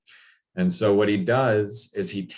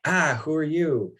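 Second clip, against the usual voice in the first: higher, startled question; startled woman; 8 semitones higher than usual. Retold or imitated. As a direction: caught off guard by an intruder. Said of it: "And she's like".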